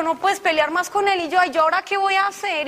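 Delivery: high, loud, mock-distraught wail; exasperated panic. Wife told by God to stop fighting her husband, exasperated panic. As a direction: whine it high in helpless protest.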